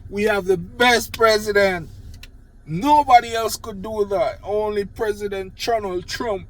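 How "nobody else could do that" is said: normal talking voice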